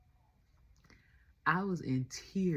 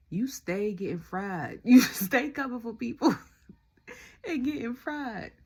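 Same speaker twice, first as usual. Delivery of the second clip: mock teasing, broken by laughter, louder in the middle; laughing teasing; 7 semitones higher, 8 dB louder than usual. Real, not acted. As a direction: tease him while cracking up. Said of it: "I was cracking up".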